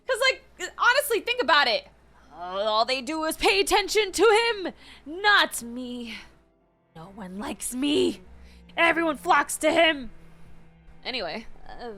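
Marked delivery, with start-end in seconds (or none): 0.1-10.1 loud, high whiny-villain parody; mock complaint. Streamer parodying the jealous apprentice, mock complaint. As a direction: act out a self-pitying grievance, louder and higher. Mockingly voices the villain's grievance.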